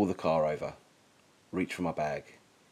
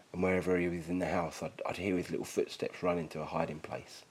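usual speaking voice

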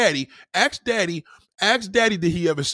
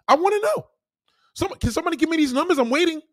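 demanding shout; 12 semitones higher, faster than usual. Real, not acted. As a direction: call out to chat for answers. Demanding answers from chat.

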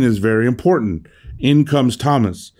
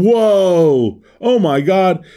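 mock exclamation; 7 semitones higher, much slower than usual. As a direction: toss off a casual startled exclamation. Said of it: "even if you're just going".

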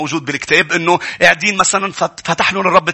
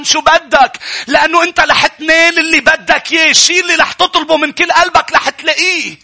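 high, raised sermon voice; full-force preaching; 13 semitones higher, 5 dB louder than usual. Acted. Preacher tells the congregation of Mary at the tomb, full-force preaching.

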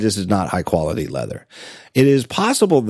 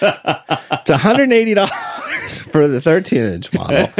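laughing through it; 9 semitones higher than usual. Real, not acted. Laughing while telling the price.

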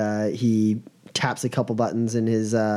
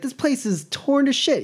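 very high, incredulous complaint; acted aghast. Boss sees his place wrecked, acted high-pitched dismay.

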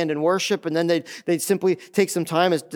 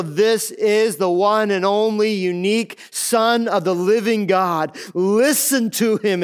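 slow, raised preaching declaration; emphatic proclamation; 3 semitones higher, slower than usual. Retold or imitated. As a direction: proclaim each word slowly, pitched up, as from heaven. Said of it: "making it abundantly clear".

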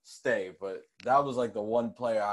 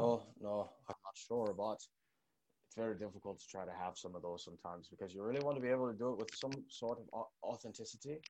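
quiet, uncertain stab at a Scottish accent drifting Irish; mixed Scottish-Irish accent; 2 semitones lower, 8 dB quieter than usual. Shown as a demonstration. Co-host asked for Scottish accent, muddled Scottish-Irish try. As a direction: attempt Scottish, land half in Irish, softly. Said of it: "I think that's an Irish accent".

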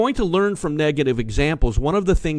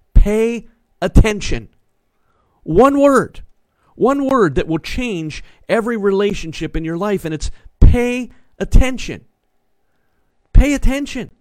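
preaching emphasis; 8 semitones higher, 4 dB louder than usual. Acted. Pastor drives his one word home to the congregation, loud and high. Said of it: "pastor's key word".

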